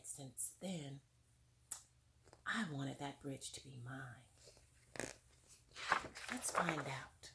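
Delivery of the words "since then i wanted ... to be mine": hushed quote. Retold or imitated. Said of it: "And she said".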